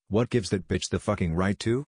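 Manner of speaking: usual speaking voice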